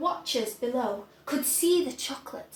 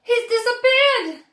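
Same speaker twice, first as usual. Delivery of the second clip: high, loud panicked yell; a mother's frantic high yell; 11 semitones higher, 11 dB louder than usual. A narrator's reading. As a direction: cry it out in alarm. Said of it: "yelled Missus Gloop".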